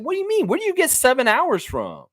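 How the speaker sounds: high, disbelieving "what do you mean"; incredulous retort. Retold or imitated. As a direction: shoot back in total disbelief.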